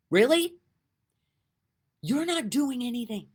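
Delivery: shouted high and loud in disbelief; incredulous. Acted. To listeners mocking the ego's blame, incredulous shout.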